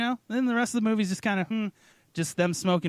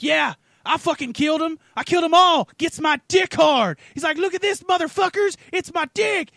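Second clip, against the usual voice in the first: crazed killer; 10 semitones higher, 4 dB louder than usual. Retold or imitated.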